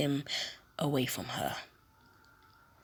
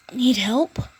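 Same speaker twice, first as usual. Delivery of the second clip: high, louder kind offer; girl's voice; 8 semitones higher, 9 dB louder than usual. Acted. Ochako kneeling to offer Deku help.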